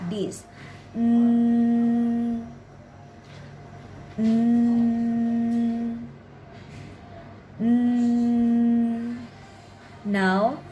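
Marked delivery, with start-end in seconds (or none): 0.9-9.2 long, steady "ng" hum for each Mm; stretched-out nasal. Shown as a demonstration. Teacher showing how to stretch the sound, Mm held as long "ng". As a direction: stretch out Mm as "ng" through the nose on one level note. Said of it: "stretch out this sound like".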